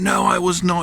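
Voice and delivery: high, loud furious denial; acted outburst, every word forced out; shouting. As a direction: shout it out in protest. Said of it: "Peter started shouting and saying".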